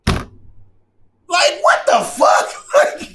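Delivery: high, slow, drawn-out incredulous squeal; laughing disbelief. Real, not acted. Vlogger baffled by absurd online comebacks, laughing in disbelief.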